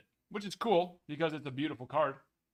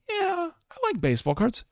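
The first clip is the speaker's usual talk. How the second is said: put-on much higher, louder, whiny kid voice; childish guest voice; 14 semitones higher, 10 dB louder than usual. Collector answering his own question in a high, whiny kid voice. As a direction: answer like an excited little kid.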